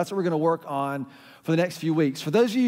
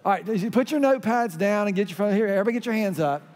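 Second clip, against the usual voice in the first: usual speaking voice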